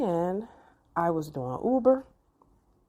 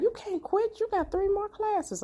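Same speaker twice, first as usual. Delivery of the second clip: much higher insistent counselor; imitating counselor. Counselor tells the student she can't quit, high voice. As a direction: squeak it like the counselor. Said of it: "She was like".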